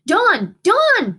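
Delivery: high, loud rising calls; shouting to wake. A narrator's reading. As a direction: shake him awake by name. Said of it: "Buddy shouted".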